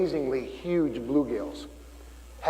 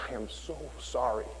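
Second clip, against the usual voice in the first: soft, much quieter, near tears; teary apology; 13 dB quieter than usual. Retold or imitated. Father apologizing to his son, soft and teary. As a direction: choke it out as a tearful sorry. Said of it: "I, I teared up".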